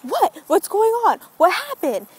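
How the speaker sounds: high-pitched mock alarm; rapid worried questions, pitched well up; alarmed mom. Mom reacting to daughter's outburst, acted high-pitched alarm.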